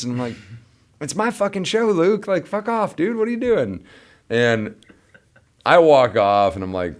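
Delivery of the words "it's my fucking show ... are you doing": high, exasperated telling-off; mock angry retort. Bandleader telling Luke off, high mock exasperation. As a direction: snap at him like an annoyed bandleader.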